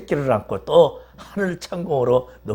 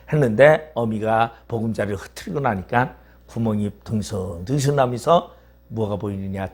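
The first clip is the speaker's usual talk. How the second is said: normal talking voice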